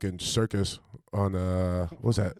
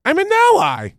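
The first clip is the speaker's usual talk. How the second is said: high, loud mocking parrot; mocking imitation; 8 dB louder than usual. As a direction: echo the line back in a silly voice. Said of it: "mimics S1's line".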